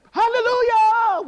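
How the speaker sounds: long, high, full-voiced praise shout; sudden shout. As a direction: belt it out like a worshiper erupting. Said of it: "just shouted".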